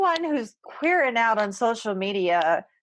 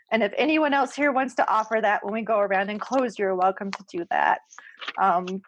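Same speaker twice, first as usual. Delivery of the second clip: ordinary delivery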